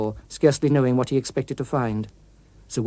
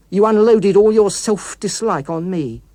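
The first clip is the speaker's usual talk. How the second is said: louder, slow, bitter reproach; bitter accusation; 6 semitones higher, 7 dB louder, slower than usual. Acted. The villain accusing his author, bitter loud reproach. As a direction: lay the blame on him hard.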